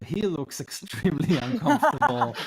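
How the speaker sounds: loud, high burst of laughter through the words; laughing. Real, not acted. Game master laughs at the awkward apology while narrating, real laughing.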